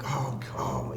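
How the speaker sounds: stunned hushed whisper. Retold or imitated. Man hearing news in a pub, shocked whisper. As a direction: breathe it out in disbelief.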